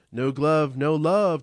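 loud, teasing jeer; taunting. Acted. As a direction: rib a friend on his way out. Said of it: "Dworkin taunts".

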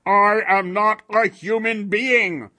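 slow, loud golem declaration; harsh monster insistence. A narrator's reading. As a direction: raise pitch and volume, each word hammered. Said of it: "the stranger said loudly".

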